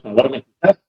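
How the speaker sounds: robotic, glitchy phone voice; glitchy robotic call. Real, not acted. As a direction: voice breaking up like a robot on a bad line. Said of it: "como que fuera robot".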